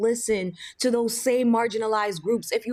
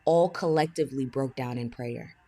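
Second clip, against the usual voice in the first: normal talking voice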